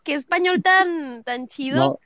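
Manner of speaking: retold delight. Host retells her reaction to the message, delighted gush. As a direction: swoon over the accent. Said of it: "yo también dije".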